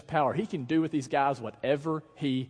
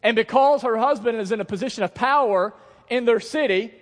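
high, loud, driving sermon voice; full-force preaching; 9 semitones higher, 9 dB louder than usual. Real, not acted. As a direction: preach it to the back row.

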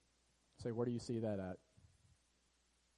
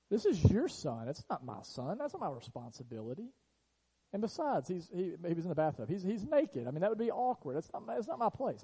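much higher, hammed-up whiny teenage excuse; mock lazy excuse; 7 semitones higher, 5 dB louder than usual. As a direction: play the selfish kid brushing off mom, mock outrage. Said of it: "I don't look at my mom and say".

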